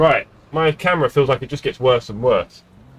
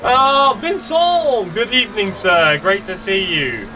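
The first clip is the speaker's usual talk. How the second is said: delighted greeting; 6 semitones higher, slower than usual. Real, not acted. Streamer greeting Vincent in the chat, delighted and high-pitched. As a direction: call out the name high with delight.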